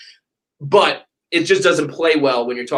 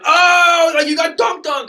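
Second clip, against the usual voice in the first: high, loud jeering crowd cry; mocking crowd shout; 13 semitones higher, 4 dB louder, slower than usual. Fans gloat about a celebrity comeback, high loud shout. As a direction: crow it like a gloating Twitter crowd. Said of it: "Everybody was just like".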